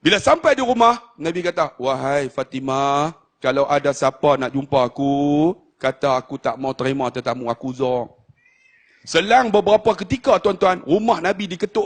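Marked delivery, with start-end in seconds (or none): 1.8-8.1 weary dying Prophet. Acted. Prophet telling Fatimah he is too ill for guests, weary. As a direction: draw each word out as if too weak.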